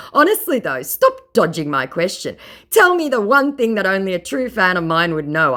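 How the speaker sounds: mocking scammer voice. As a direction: read it like a pompous fraud bragging. Reads scammer's chat in put-on voice.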